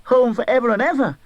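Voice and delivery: put-on high, bright-eyed child voice; a giddy little sister bursting with news; excited little girl. A narrator's reading. Little sister telling her brother he's coming home, high excited child. Child Fan speaks to Scrooge.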